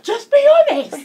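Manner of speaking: very high, loud demanding shout; hammed-up aggressive yell through laughter. Daughter demanding honesty from her dad, aggressive. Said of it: "Really aggressively".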